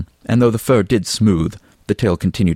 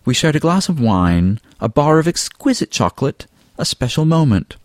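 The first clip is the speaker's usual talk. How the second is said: higher-pitched, leisurely recounting; a lightly theatrical, higher character voice; lightly voiced Fabian; 9 semitones higher than usual. A narrator's reading. Fabian tells friends about a shared moment, lightly theatrical.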